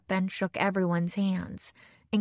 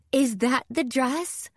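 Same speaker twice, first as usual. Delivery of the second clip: slow, higher-pitched excited question; thrilled, drawn-out exclamation; exclaiming; 5 semitones higher than usual. A narrator's reading. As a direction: exclaim it with rising delight. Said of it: "she exclaimed".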